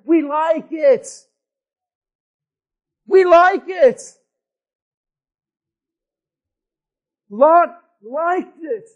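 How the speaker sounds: loud, slow, hammering preacher; preaching at full force. Acted. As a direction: pound each line at the crowd, slowly. Preaching climax.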